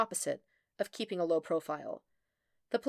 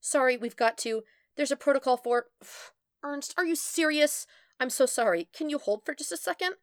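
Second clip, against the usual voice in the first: higher, harried phone voice; frantic, pitched-up apologising; flustered; 7 semitones higher, 4 dB louder than usual. A narrator's reading. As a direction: juggle apology and exasperation. Frantic girl during lab mishap.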